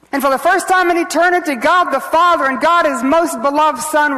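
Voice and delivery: high, forceful sermon delivery; impassioned preaching. Real, not acted. Preacher tells listeners of the Son separated from God, impassioned. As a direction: preach it with rising intensity.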